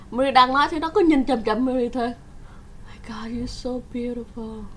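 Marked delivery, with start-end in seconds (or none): 2.9-4.6 dazed stranger. Retold or imitated. Man stares, repeats one line.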